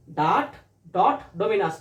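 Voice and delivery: ডাট, ডট, ডোমিনাস said very slowly, word by word; slow repeat-after-me. Shown as a demonstration. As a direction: spell it out slowly for students to echo. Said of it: "আমার সাথে সাথে বলো". (ধীরে ধীরে উচ্চারণ করে শেখানো)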